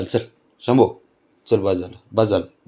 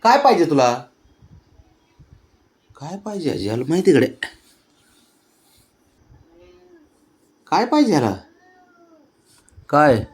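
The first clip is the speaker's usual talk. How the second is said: much higher, louder sing-song questions; 9 semitones higher, 7 dB louder than usual. Real, not acted. Grandmother offering toddler a plant to play, high sing-song.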